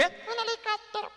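put-on small boy's high pleading voice; childlike plea. Little boy begging his mom for a helicopter, high childlike plea. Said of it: "Yo le decía".

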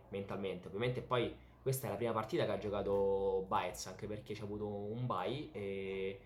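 normal talking voice

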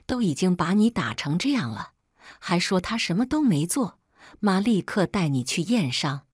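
plain reading voice

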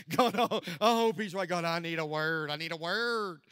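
exaggerated retold begging; mock desperate prayer. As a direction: cry out to God in comic desperation. Repeats the prayer as loud plea.

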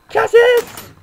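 loud high yell of a name; shout. Real, not acted. Kid yelling a rival player's name. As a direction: call him out at full volume.

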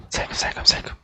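unvoiced sneaking whisper; whispering while hiding. Real, not acted. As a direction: breathe it out silently. Sneaking past the witch.

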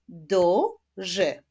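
"Duży" in two separate beats, first drawn out; split into syllables. Shown as a demonstration. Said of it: "Wyklaszczmy sylaby w tym wyrazie".

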